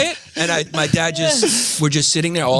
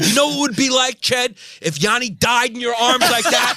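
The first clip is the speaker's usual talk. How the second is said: high, half-laughing mock shout; imitated yelling dad; 8 semitones higher than usual. Dad turning to the boys in the back seat, high mock shout. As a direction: yell it back at the kids in the car.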